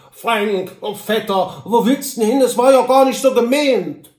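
high, loud pleading call; calling after him. A narrator's reading. Droll to his departing cousin, calling after him. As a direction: call out to a cousin riding away. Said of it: "Droll rief ihm nach".

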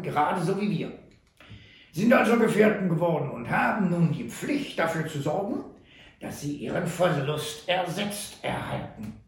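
put-on coolly unhurried old trapper; Sam Hawkins. A narrator's reading. Sam calmly demands payment for the ox, dry.

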